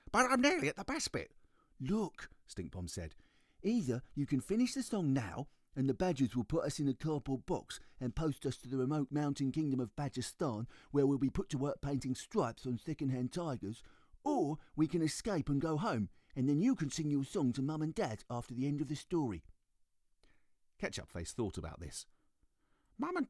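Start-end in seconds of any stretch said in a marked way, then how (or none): 0.1-1.3 high, pleading little sister; a child begging to keep singing; little girl voice. Acted. Ketchup Face voiced by narrator. 1.8-19.4 put-on a touch higher, earnest older brother; patiently reasoning with his little sister; lightly voiced boy. Stinkbomb voiced by narrator.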